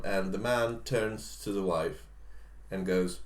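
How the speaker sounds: ordinary talk, nothing marked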